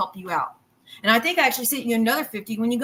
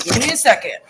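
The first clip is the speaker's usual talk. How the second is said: louder, firmer repeat of the ask; 6 dB louder than usual. Real, not acted. Cooking streamer insists chat wait, louder. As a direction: push it out louder the second time. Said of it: "Y'all, give me a second".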